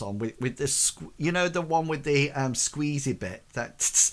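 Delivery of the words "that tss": spray hiss. Shown as a demonstration. Imitates perfume spray.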